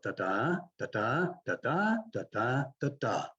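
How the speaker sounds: "da-da" lifted at the end each time, last one falling; demo rising rhythm. Shown as a demonstration. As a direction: hum the comma tune, up, up, then down. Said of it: "use upward inflections on the commas".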